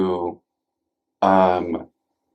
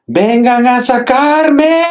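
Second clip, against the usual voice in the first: put-on high, loud, drawn-out toddler yell; imitated childhood yell; 6 dB louder, slower than usual. Retelling mom's story of yelling from the crib, imitated yell. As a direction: yell it out like a kid stuck in a crib. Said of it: "you would yell out".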